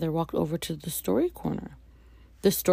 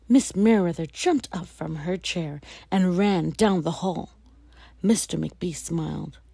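plain reading voice

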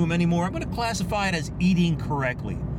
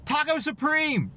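called out; 12 semitones higher, slower than usual. Retold or imitated. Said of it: "I just started shouting things out".

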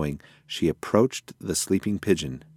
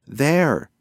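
higher, pointed exclamation; excited discovery; 6 semitones higher, 4 dB louder than usual. A narrator's reading. Mouse points out a rooftop to pigeon, mildly excited. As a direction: announce the find with a lift in pitch.

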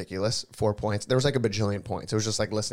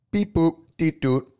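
phone dialing noises; 7 semitones higher, 6 dB louder, slower than usual. Retold or imitated. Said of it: "And I go".

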